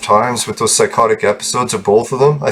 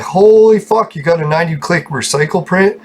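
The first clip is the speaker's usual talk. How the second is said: imagined amazed reaction; 6 semitones higher than usual. Pretend. Viewers reacting to his imagined recycle print, in mock amazement. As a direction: act out a stunned onlooker, pitched way up.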